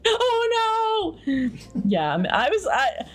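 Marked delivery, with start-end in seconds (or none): 0.0-1.5 high-pitched giggling dismay; high laughing oh no. Retold or imitated. Child realizing he stole avocados, high laughing squeal. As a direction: squeal a laughing oh no, much higher. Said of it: "And I was like".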